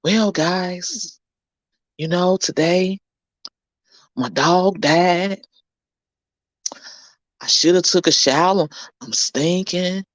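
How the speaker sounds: low, slow, dragging downer voice; put-on mopey drag, word by word; mock gloomy vlogger. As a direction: parody a miserable host with no energy. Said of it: "if I'm just".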